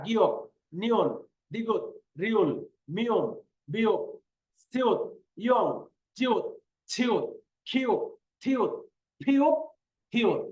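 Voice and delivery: each letter name on its own beat; counted recitation. Shown as a demonstration. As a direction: count them off one at a time, with short gaps. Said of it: "এভাবে চোদ্দটা গনে গনে পড়বেন". (গুনে গুনে একটা একটা করে পড়া)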